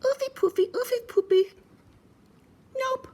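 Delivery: very high, tiny piggy; cheeky little pig refusing; piggy singsong then a stubborn nope; squeaky piggy voice. Acted. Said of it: "She answered".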